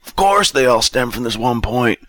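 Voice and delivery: annoyed. A narrator's reading. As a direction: snap back in exasperation as the drunk. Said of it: "Bob said, annoyed".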